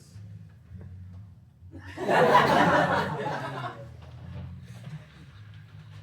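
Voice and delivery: long breathy laughing fit; can't hold the laugh in; wheezy helpless laughter; breathless giggle. Real, not acted. Giggles mid-sentence.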